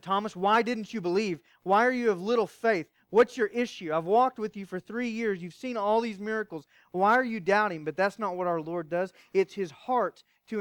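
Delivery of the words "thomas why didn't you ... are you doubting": loud, higher mock rebuke; exasperated scolding. Preacher pretending to scold Thomas, loud exasperation. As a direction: scold him like a fed-up friend. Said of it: "I'd have jumped in and said".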